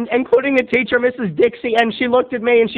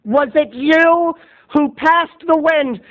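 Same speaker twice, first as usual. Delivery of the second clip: high, slow accusing question; teacher grilling the boy, high and slow; stern teacher; 7 semitones higher, slower than usual. Retold or imitated. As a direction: demand an answer from the culprit. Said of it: "And Missus Dixon said".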